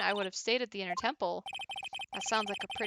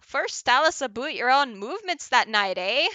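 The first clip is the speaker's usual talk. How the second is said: loud, high mock-Canadian judge; Canadian accent judge; 4 semitones higher, 8 dB louder than usual. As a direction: put on a hammed-up Canadian accent, loudly. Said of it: "because apparently he's Canadian".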